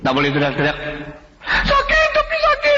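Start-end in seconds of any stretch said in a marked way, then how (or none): 1.4-2.8 high wailing cry; pained wail. Acted. Patient pleading with strict nurse, high pained wail. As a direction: whimper out the pain high.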